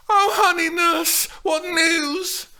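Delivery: put-on high, loud eager girl's voice; screeched excitedly. A narrator's reading. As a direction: plead eagerly as young Juliet. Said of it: "Steph screeched excitedly".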